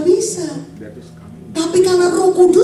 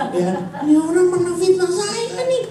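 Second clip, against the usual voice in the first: rising high, indignant grumble; mock-indignant muttering that climbs high; riled up at a slanderer; 5 semitones higher than usual. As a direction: work yourself up into high, mock outrage. Acts out meeting a slanderer.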